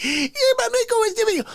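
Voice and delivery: put-on high, fast girly voice; girl voice. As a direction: squeak it like a sassy girl. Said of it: "She's like".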